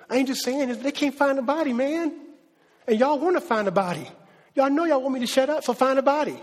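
high-pitched, pressing challenge; insistent challenge. Acted. Paul challenging doubters to find the body, insistent.

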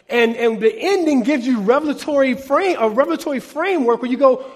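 ordinary talk

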